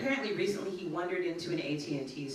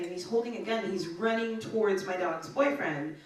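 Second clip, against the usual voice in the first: usual speaking voice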